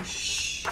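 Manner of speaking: a hushed troll shush. Acted. Troll under the bridge shushing Fern. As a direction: shush her like a grumpy troll. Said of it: "troll underneath the bridge saying".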